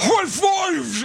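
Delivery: big dumb meathead yelling high fives; dopey macho hunk. Retold or imitated. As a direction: bark it like a meathead jock. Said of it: "he's got, like, the meathead voice".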